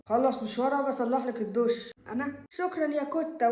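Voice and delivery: ordinary talk, nothing marked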